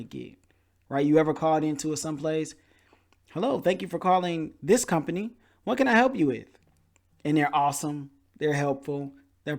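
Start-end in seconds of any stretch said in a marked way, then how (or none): 3.3-6.5 high, bright mock customer service greeting; cheery phone agent. Caller imitating a friendly company agent, high and cheery. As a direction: chirp it like a cheerful call center rep.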